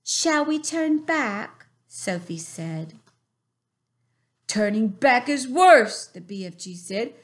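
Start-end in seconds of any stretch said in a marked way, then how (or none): 0.0-1.7 put-on a touch higher, slow small girl's voice; lightly voiced Sophie. A narrator's reading. Sophie whispering to her friend, a light girl's voice. 4.5-6.0 a hint of a big friendly giant; a bit louder, homely giant voice; lightly voiced kind giant. A narrator's reading. BFG answering Sophie, a lightly voiced giant.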